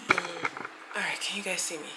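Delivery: hushed check. Real, not acted. Quietly checking stream.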